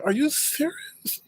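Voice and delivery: high, soft, drawn-out mimicry; badly faked high voice; terrible impersonation. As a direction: squeak it out slowly in mockery. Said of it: "That was a terrible impersonation".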